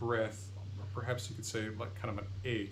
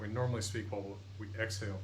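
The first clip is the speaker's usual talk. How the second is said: ordinary talk, nothing marked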